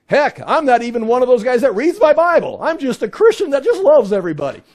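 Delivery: high, loud mock-innocent protest; exaggerated disclaimer, loudly sent up; mocking excuse. Mocks excuse-making, non-studying Christians.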